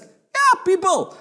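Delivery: excited call to viewers; hype yeah. Acted. Hyped call-out.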